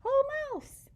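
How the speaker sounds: high, loud, cheery call; hare's greeting. A narrator's reading. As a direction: greet your old friend brightly. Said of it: "says Hare".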